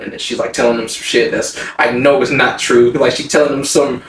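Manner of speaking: ordinary talk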